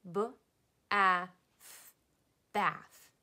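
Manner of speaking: "B, A, th" tapped out loud, then blended into "bath"; sounding out "bath" together with the class. Shown as a demonstration. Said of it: "Let's tap it out together".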